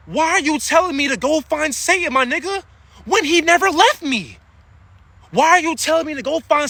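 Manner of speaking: indignant outburst. Real, not acted.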